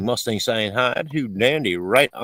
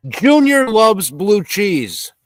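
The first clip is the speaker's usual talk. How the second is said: mock proclamation; 8 semitones higher, 7 dB louder, much slower than usual. Reading chat, mock announcing Junior's taste.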